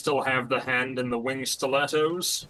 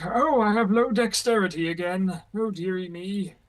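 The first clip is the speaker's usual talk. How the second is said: put-on high, fussy old-timer voice; a light quavery grandpa whine; old arthritic character; 6 semitones higher, 4 dB louder than usual.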